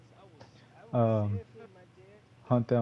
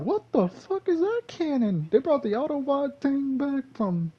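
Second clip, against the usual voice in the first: amazed. Retold or imitated. Gamer amazed the Autobot cannon came back, very high. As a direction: marvel at it in a high squeal. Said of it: "I was like".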